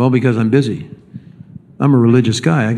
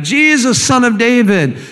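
high, straining call to Jesus; crying out; 9 semitones higher than usual. Acted. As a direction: call across the crowd, pitched high. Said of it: "he's crying out".

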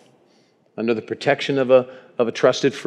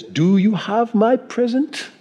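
put-on slow, high English-accented question; old English nobleman, slow and pitched up; 10 semitones higher, slower than usual. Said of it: "He didn't say it with an English accent".

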